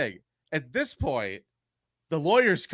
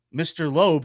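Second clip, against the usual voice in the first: normal talking voice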